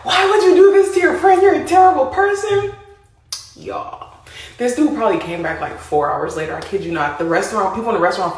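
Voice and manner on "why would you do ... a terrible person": high, loud scolding voice; cussing him out. Retold or imitated. Restaurant owner scolding the friend, high and loud. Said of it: "started cussing him out".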